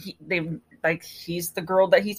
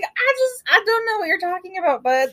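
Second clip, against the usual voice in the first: much higher, quick baffled dude voice; mock confused dude; 10 semitones higher than usual. Host mimics Jake baffled by Ryder's accusation, high and quick. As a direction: squeak it out like a baffled bro.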